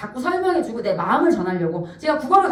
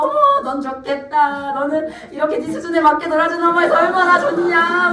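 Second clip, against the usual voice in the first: higher, lilting mock-granny admiration; imitated admiring grandmothers; 5 semitones higher, 3 dB louder than usual. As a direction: coo enviously like onlooking grandmas. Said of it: "옆에 할머니들이 막".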